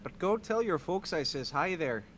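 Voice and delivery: a hint of a Minnesota bud accent; mock Upper Midwest folksy accent; folksy Midwestern accent. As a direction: lay on the folksy there bud. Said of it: "talk just like your accent".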